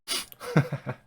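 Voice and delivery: real breathy burst of laughter; helpless airy chuckle; wheezy laugh. Real, not acted. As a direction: laugh at your own punchline. Storyteller laughs at own ending.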